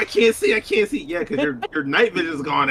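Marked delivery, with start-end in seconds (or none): 0.0-1.0 imitating panicky gamer. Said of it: "she's talking about".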